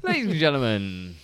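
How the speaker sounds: showman's booming announcement; ringmaster. Shown as a demonstration. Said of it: "Start again".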